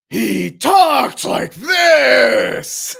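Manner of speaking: very slow, high, loud strained shout; Knife. Shown as a demonstration. Reminding chat of an old role, exaggerated slow shout. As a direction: exaggerate an old character voice, loud and hugely drawn out. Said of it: "what Knife sounded like".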